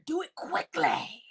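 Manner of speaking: soft-voiced imitation of a catchphrase; Regina impression. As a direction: mimic her softly, with light mockery. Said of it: "we got Regina over here".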